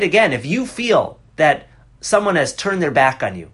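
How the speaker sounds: ordinary delivery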